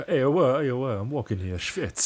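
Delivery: hammed-up New York accent, loud at the start; a mock New Yorker tossing in Yiddish; cartoonish New York accent, half-joking; bad New York accent. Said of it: "It's a bad New York accent".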